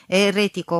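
spat out loudly with scorn; contemptuous insult. A narrator's reading. Mother scorning her daughter as a heretic, loud contempt. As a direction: throw the word at her with disdain. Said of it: "m-a insultat cu dispreț".

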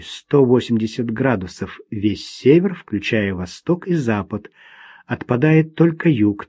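ordinary delivery, nothing marked

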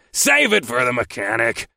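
grumbling boss cutting in; curt, irritated grumble; grumbled. A narrator's reading. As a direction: brush off the excuse grumpily. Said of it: "he grumbled".

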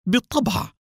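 high-pitched surprised confirmation; mildly astonished, pitch jumping up. A narrator's reading. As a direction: confirm it as if taken aback. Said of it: "بدهشة وقال".

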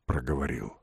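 usual speaking voice

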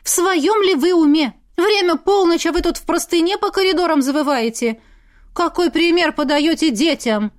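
high, loud outraged scolding; indignant scolding. Acted. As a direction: scold her in full outrage. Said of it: "Я в возмущении всплеснула руками".

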